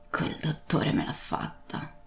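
whispered, slow and weak; faint whispered complaint; murmured. Acted. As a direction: breathe it out, exhausted. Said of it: "mormorò".

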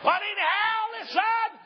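high, loud preacher's call; preaching shout. Real, not acted. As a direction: rouse the congregation. Preacher rousing the crowd.

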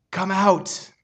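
ordinary delivery

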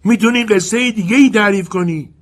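a small voice calling out of the dark; Jenny's voice. Acted. Jenny calls from the darkness, high and bright. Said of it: "جنی از تاریکی گفت".

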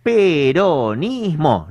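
Peronismo drawn out slowly, syllable by syllable; stress on Peronismo. Real, not acted. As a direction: stretch Peronismo slowly and firmly. Corrects "populismo" emphatically.

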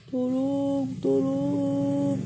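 trapped lion shouting for help; weak trapped cry for help. Acted. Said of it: "teriak si singa minta tolong".

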